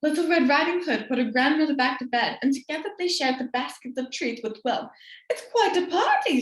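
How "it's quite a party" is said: laughing granny. Acted. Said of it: "said grandmother".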